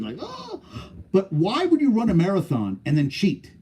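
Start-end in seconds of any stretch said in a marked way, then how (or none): 0.2-0.6 high gasping Oh with a laugh; hammed-up breathless gasp; mock gasp. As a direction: imitate a runner gasping at the finish. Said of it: "like, gasping".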